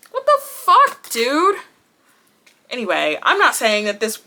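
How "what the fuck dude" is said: high, loud and exasperated; fed-up outburst. Real, not acted. Annoyed at the stuck face mask, she bursts out high and loud. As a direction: blow up at the thing that's bugging you. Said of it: "now I'm mad".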